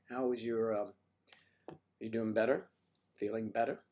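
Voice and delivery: usual speaking voice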